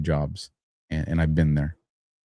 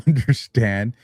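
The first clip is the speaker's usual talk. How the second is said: ordinary delivery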